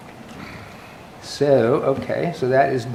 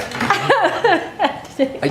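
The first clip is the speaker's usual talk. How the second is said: laughing. Real, not acted. Chair laughing at the joke.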